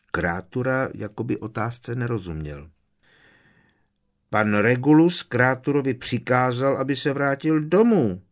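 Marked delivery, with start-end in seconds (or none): 4.3-8.2 a higher, louder elf voice repeating the order by rote; the old elf insists, as if deaf to the question; repeating house-elf. A narrator's reading.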